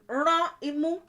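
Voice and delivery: Ránmú said high with the nose squeezed; squeezed-nose demo. Shown as a demonstration. Teacher showing students how to squeeze the nose. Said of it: "squeezing the nose".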